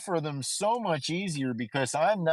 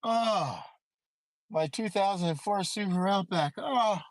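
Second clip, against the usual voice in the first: mock tearful gratitude; 5 semitones higher than usual. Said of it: "Now I'm like".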